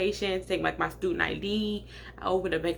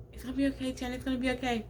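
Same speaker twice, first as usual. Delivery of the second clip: reassuring sister; 5 semitones higher, 5 dB quieter, much faster than usual. Retold or imitated. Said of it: "trying to".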